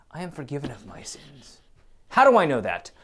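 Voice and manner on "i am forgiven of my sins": whispered, softer and unvoiced. Real, not acted. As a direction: breathe it as a private confession. Whispered confession amid sermon.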